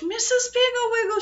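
high, singsong holler for someone out of sight; shouted call. A narrator's reading. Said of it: "she shouted".

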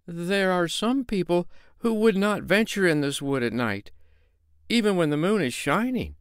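the tinker showing off his courage; boastful tinker. Acted. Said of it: "said the tinker".

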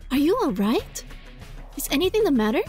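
usual speaking voice

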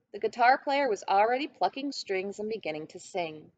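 usual speaking voice

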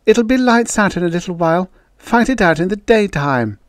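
high-pitched appeal; acted peacemaker's plea; pleading. A narrator's reading. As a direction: plead with both sides to hold off. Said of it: "she pleaded".

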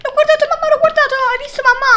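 high, frantic cry to mum; shrieked plea for mum's attention; panicked appeal. Real, not acted. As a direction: wail it out in alarm.

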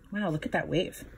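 ordinary delivery

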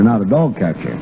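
ordinary talk

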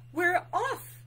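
high, loud happy mouse cry; excited exclamation. A narrator's reading. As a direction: exclaim it with bouncy delight. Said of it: "exclaimed Mouse, with a happy wag".

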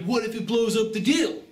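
high mock-fretful voice; mock the timid question up high; mocking weak seller. Coach mocking timid seller's worry, high fretful voice. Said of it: "weak questions like".

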